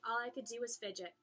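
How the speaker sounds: ordinary delivery, nothing marked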